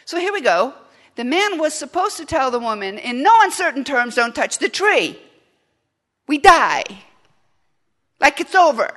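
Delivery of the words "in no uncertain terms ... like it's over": loud, high urgent warning; mock husband barking a warning; forceful warning. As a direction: shout the warning like life depends on it. Man voicing warning to woman.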